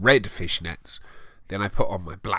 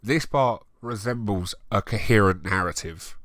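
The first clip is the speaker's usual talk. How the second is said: ordinary delivery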